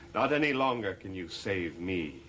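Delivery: normal talking voice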